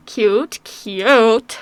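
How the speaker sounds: ordinary talk, nothing marked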